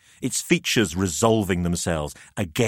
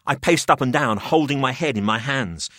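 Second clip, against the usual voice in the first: ordinary delivery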